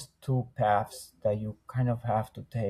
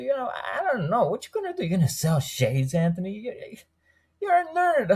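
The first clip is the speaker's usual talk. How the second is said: high, doubtful imitation of his wife; mocking his wife; 9 semitones higher than usual. Man retelling his wife's doubts about his plan, in a high mocking voice. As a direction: lift the voice high to mimic a sceptical wife. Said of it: "she said".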